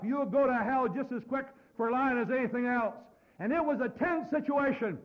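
high, loud, driving sermon delivery; forceful preaching. Real, not acted. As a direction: preach it hard at the congregation.

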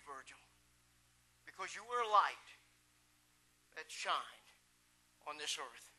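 hushed, tender farewell; solemn tribute. Real, not acted. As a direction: speak gently to the departed. Recalls closing funeral tribute.

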